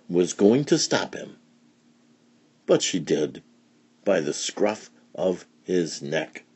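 ordinary delivery

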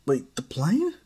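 "the plane?" asked high with a sharp rise; startled question. Acted. Izuku asking Darth Maul, startled and rising.

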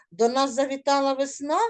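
весна stressed, quick rising line. Shown as a demonstration. Teacher models the correct reading for pupils, весна stressed. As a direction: push toward весна. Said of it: "я вам розкажу, як треба було прочитати".

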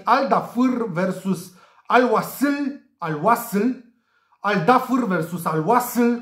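slow, careful "Al Dafr versus Al Wasl"; attempted correct pronunciation. Shown as a demonstration. Tipster tests the right names for viewers, slow and careful on "Al Wasl". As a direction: sound out "Wasl" as "WA-sul", "Dafr" as "DA-fur". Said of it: "cred că așa s-ar pronunța corect".

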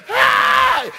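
high, loud drawn-out "hey"; acted shout of astonishment; woman's shout. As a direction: cry out at full voice. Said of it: "The woman shouted".

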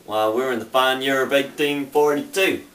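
friendly American Southern bartender voice, lightly put on; mock Southern accent. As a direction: greet a stranger like an old-time saloon keeper in a Southern accent. Cowboy bartender role.

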